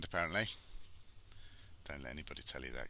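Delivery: ordinary talk, nothing marked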